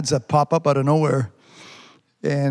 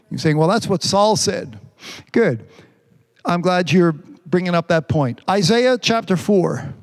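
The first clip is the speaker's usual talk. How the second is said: raised, sermon cadence; preaching; 5 semitones higher than usual. Acted. Pastor preaching to his church, full sermon voice. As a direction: preach it to the congregation.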